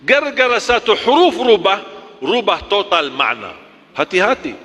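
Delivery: ordinary delivery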